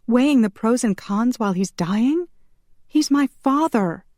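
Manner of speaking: high, anguished protest; outraged yell. A narrator's reading. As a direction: protest for your dying father, pitched high. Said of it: "Shanna yelled".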